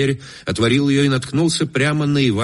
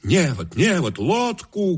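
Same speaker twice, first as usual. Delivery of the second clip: high, slow shouted orders; shouting; 8 semitones higher, slower than usual. A narrator's reading. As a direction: call for the net and the boat, high and drawn out. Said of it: "кричали другие голоса". (высокий протяжный крик)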